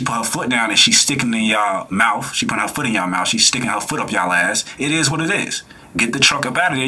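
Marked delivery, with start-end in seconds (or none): none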